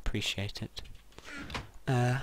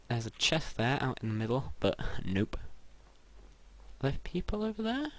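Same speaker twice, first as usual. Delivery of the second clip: ordinary talk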